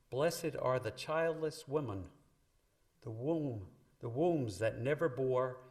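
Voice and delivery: solemn Bible recitation, projected and measured; scripture proclamation. Acted. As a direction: proclaim the verse to the whole congregation, drawing out each phrase. Reads scripture aloud to the congregation.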